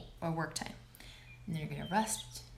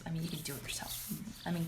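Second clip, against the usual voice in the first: ordinary talk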